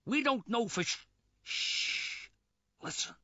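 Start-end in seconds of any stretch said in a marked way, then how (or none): 1.4-2.3 long soft shush; hushing shh. Acted. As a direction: shush them sharply to listen. Hushing the others to listen.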